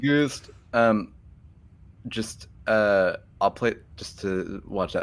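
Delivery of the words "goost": "Ghost" said as goost; mimicked odd pronunciation. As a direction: say "Ghost" with an oo, pitched up. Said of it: "the pronunciation of ghost".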